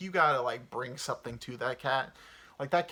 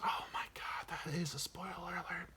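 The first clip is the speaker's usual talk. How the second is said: hushed whisper; secretive whisper; whispered spoiler; 5 semitones higher, 9 dB quieter than usual. Real, not acted. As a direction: whisper it so nobody hears the spoiler. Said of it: "spoiler whispered".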